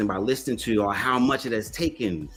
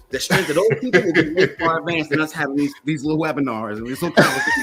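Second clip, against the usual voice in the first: words bubbling with laughter, a bit louder; laughing; 5 semitones higher, 6 dB louder than usual. Real, not acted. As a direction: laugh through the punchline as you say it. Laughing while imagining Nat Turner.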